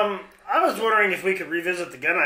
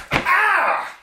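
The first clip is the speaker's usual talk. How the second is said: high yelp of pain; pained cry; 10 semitones higher, 3 dB louder than usual. Acted. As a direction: cry out as the punch lands. Henchman gets punched.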